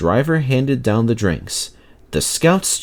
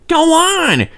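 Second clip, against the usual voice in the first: much higher, loud eager push; urging; 5 dB louder than usual. A narrator's reading. Peter Sam urging Sir Handel on, high and loud. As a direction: egg him on to keep telling. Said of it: "urged Peter Sam".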